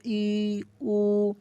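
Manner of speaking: ई and उ drawn out, mouth small; close vowels. Shown as a demonstration. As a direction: sustain each vowel through a narrow opening. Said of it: "छोटा सा हो रहा है ना". (छोटे मुंह से लंबा ई, उ)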